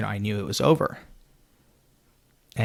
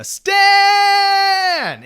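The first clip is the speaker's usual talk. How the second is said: full-throated high shout of his name; a last-ditch yell to get Stan back; desperate yell; 7 dB louder than usual. Retold or imitated. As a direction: scream his name across the room, desperate. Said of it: "yelling uncontrollably at the top of my lungs".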